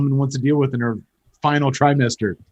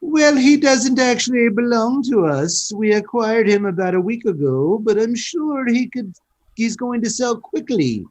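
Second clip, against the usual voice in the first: put-on terrible British accent; 9 semitones higher than usual. Old shopkeeper tells customer about the clown doll, bad British accent. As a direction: put on a hammy, high-pitched British accent. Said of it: "That's a terrible accent".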